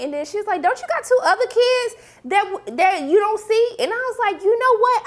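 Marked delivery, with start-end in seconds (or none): 0.6-3.8 high, sassy mock jab. Baby mama jabbing [name] about his kids, high sass. 4.4-5.0 high, louder burst of agreement; excited agreement. Real, not acted. Vlogger eagerly agreeing with the grandmother, high excited.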